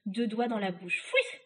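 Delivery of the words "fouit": high spoken whistle noise; imitated whistle. Witch summoning her sisters, high imitated whistle. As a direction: voice a sharp two-finger whistle. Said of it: "Elle appela ses sœurs en sifflant".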